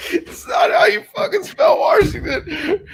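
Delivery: high, loud laughing disbelief; teacher laughing in disbelief at the spelling; laughing shout. Retold or imitated. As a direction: shout it while cracking up. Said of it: "he was like".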